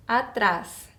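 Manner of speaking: normal talking voice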